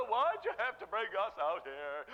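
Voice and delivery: loud hammed-up wail with laughter; mock whining. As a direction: whine like a put-upon complainer. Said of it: "you quit your whining".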